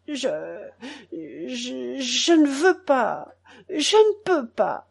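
stammering, then high, drawn-out protest; halting tearful refusal, rising in pitch; choked by tears. A narrator's reading. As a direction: struggle to get the words out. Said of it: "les larmes qui m'étouffaient".

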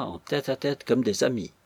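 plain reading voice

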